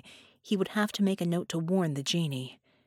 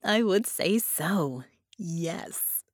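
Harmony's voice, amused; much slower than usual. Acted. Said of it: "rich with amusement".

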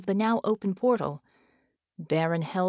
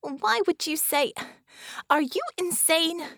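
higher, disbelieving scold; exasperated outburst; 10 semitones higher than usual. A narrator's reading. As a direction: throw it at him in disbelief. Bella scolds Bull after alarm.

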